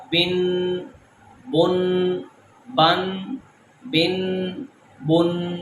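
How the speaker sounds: بن with a clear final n sound; nunation demo. Shown as a demonstration. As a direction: sound the nun at the end of بن. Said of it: "ہم اس کو پڑھیں گے بن".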